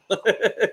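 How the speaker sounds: a quick breathy chuckle after the story; short laugh. Real, not acted. Father laughing after telling the podcast hosts about his daughter. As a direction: laugh it off lightly.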